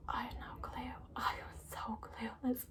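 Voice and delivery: sheepish hushed whisper. Real, not acted. Admitting to viewers she had no clue, whispered. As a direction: confess it under your breath, embarrassed.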